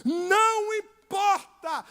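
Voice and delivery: loud, driving sermon delivery; preaching at full force. Acted. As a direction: hammer the point home to the congregation. (pregação inflamada, em voz alta)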